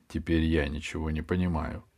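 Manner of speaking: usual speaking voice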